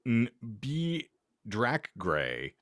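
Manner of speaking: ordinary delivery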